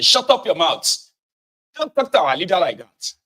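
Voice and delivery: loud scolding jab; a mock scolding shout; mock angry commenter. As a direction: bark it like an offended supporter.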